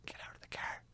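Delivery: tense, hushed order; urgent whisper. Retold or imitated. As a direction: whisper it so as not to alarm. Warning wife about spider.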